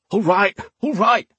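high-pitched, hurried giving in; rattled thug backing down; sputtered surrender. A narrator's reading. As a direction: blurt it out with a blade at the neck. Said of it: "he sputtered".

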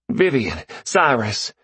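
high, eager call of names; a caller bursting with news; excited greeting. Acted. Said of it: "His voice was choked but exhilarated".

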